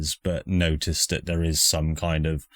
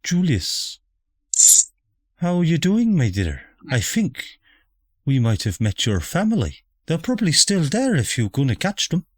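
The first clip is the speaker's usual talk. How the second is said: lightly voiced chatty mother; prim mother, a touch higher; posh mother voice; 11 semitones higher than usual.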